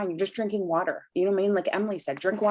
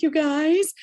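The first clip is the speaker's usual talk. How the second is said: high, louder retold squeal to followers; excited announcement; 8 semitones higher, 4 dB louder than usual. Telling followers her big jeans news on camera, excited. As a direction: call out "you guys" high and rising.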